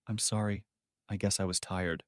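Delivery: soft, drained and hollow apology; empty, hushed voice; hollow, soulless. A narrator's reading. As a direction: say it with nothing behind the words, very softly. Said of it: "Her voice was hollow, soulless".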